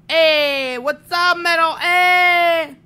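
high, loud excited hail; shouted greeting. Real, not acted. Streamer welcoming a viewer to the stream, shouted greeting. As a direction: holler hello, drawing out the heys.

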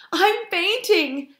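high, drawn-out faint gasp. A narrator's reading. Failed fox back from the farmyard, gasping high and faint. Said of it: "gasped Frank".

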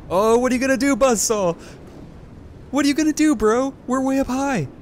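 loud, high mock taunt at the enemy; cocky high-pitched jeer. Real, not acted. Taunting enemy buzzsaw unit.

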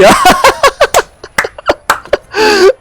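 shrieking burst of laughter; loud high delighted cackle. Real, not acted. After the kill.

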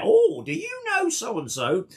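high, slow, sing-song imitation of a curious stranger; mock eager stranger. DJ mimics strangers asking about mutual friends, high slow sing-song. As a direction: stretch the oh way up like a nosy acquaintance. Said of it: "whereby somebody knows that you're from somewhere".

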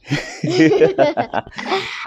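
a cartoonish high laugh; squeaky high laugh. Dad laughs in funny voice.

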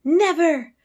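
high, loud astonished cry; shocked maid crying out; exclaimed. Acted. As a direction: throw it up high in disbelief. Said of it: "she exclaimed".

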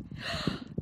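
sharp breathless gasp of delight; excited gasp. Real, not acted. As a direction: gasp at something lovely. Unboxing a gorgeous book.

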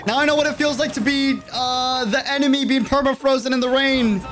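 high-pitched dramatic complaint; anguished lament. Real, not acted. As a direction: wail it out as the suffering victim. Laments being frozen by the enemy.

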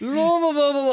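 high-pitched gabbled blah-blah; high, self-important mock chatter; mock chattering drama students. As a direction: babble like actors talking over each other. Mimicking drama school people talking over.

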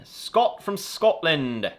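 high, loud mock-Scottish accent; bad Scottish accent. Greeting a viewer from Scotland, in a bad Scottish accent. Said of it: "I don't know why I did that accent".

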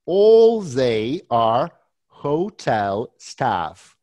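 very slow, deliberate summing up; slow summary. Shown as a demonstration. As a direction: spell it out slowly for the student. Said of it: "teacher summarizes slowly".

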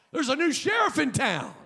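high, loud, fired-up proclamation; preaching shout. Real, not acted. Pastor firing up the congregation, shouting with joy. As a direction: bellow it like a victory cry.

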